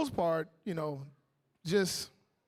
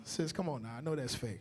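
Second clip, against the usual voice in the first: ordinary talk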